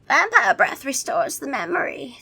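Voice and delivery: put-on high, instructive old vampire; high-pitched vampire voice; vampire impression. A narrator's reading. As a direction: explain it as a theatrical vampire. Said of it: "my vampire impression".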